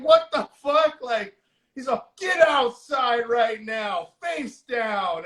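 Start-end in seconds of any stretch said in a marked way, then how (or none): 0.0-1.0 high-pitched mock outrage; retold disbelief. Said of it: "And she's like". 2.2-5.2 high, drawn-out command, easing off at the end; mock sheriff order. Retold sheriff ordering the kids out.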